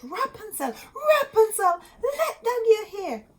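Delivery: high, loud call up the tower. A narrator's reading. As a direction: call up to the window eagerly. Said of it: "came and called out".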